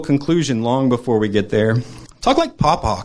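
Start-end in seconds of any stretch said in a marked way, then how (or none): 2.2-3.0 put-on much higher, eager child's requests; little girl voice. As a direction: play a little girl firing off demands. Niece asks for grandpa impression.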